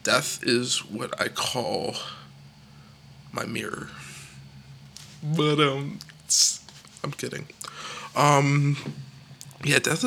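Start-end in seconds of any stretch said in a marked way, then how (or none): none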